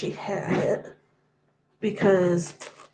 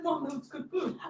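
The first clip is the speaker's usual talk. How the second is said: soft, lightly raised voice for a cat; voicing the cat; 5 semitones higher, 11 dB quieter than usual. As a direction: speak quietly as a pet pleading its case. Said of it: "He's like".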